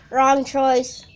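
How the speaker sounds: loud. Real, not acted. Scolding the game.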